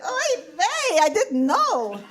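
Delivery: put-on high, squawking Yiddish accent wife; a kvetching Yiddish bride. Esther voiced, high.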